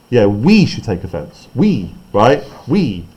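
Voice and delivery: "we" leaned on a little harder, the rest plain; single out "we" in "we should take offence"; stress on WE. Shown as a demonstration. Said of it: "it tells the actor to emphasise that word in particular".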